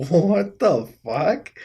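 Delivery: slow, amused disbelief with a laugh. Real, not acted. Vlogger laughing at an absurd octopus photo, amused disbelief. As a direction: laugh through a stunned what the fuck.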